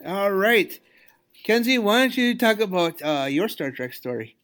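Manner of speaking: ordinary delivery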